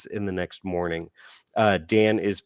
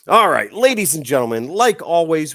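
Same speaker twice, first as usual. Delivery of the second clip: hyped announcer; 6 semitones higher, 8 dB louder than usual. Acted. Host hypes show opening.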